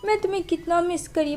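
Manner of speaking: a touch of phony, plaintive fondness; fake affection. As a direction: imitate a pretend mother's put-on longing. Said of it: "बस वो ढोंगी कर रही होती है".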